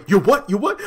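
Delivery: high, loud, incredulous; mocking outrage. [name] mocking Andy's apology, incredulous. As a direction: throw it back at her.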